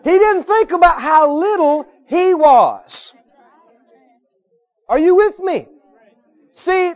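high, loud, stretched pulpit cry; full-force preaching. Real, not acted. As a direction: drive each phrase home to the congregation at the top of your voice.

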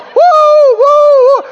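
high, loud sustained whoops; piercing drawn-out preacher's whooping; imitated holler. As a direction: holler long "woo"s right behind someone's head. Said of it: "from him hollering, going".

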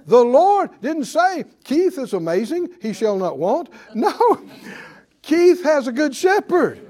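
loud, high preaching build; full-force preaching. Acted. Preacher building to the congregation, loud and high.

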